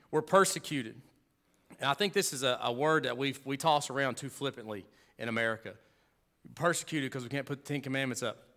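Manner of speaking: usual speaking voice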